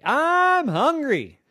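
drawn-out high hungry whine; whiny kid. Acted. Child character whines hunger.

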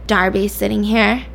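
ordinary talk, nothing marked

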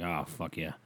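the cheer he kept in his head, said quietly; inner thought. Retold or imitated. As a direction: say it to yourself quietly. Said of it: "That's the noise I made in my head".

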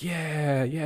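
high, gleeful yeah; celebrating donkey. Retold or imitated. Donkey celebrating his win, high and gleeful. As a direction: cheer like you just won. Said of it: "celebrating, is like".

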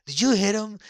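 high, loud referee demanding an answer; mock referee. Stepdad's favorite bit, referee confronting Eddie, mock yell. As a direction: bark it like a startled ref. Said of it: "the referee would be like".

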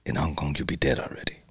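low, hushed menace; vicious villain. Retold or imitated. Jet Li's villain warning someone disrespectful, menacing. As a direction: imitate a villain's soft, low threat. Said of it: "It's a vicious villain".

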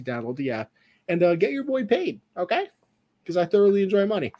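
upbeat, high, hyped promo read. Acted. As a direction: sell the promo code.

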